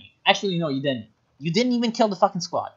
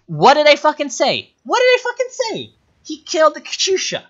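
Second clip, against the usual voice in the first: high, loud, outraged I-told-you-so; vindicated shouting; 11 semitones higher, 6 dB louder than usual. Real, not acted. Shouting as the predicted Katyusha kill happens, vindicated. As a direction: exclaim it, pitch shooting way up.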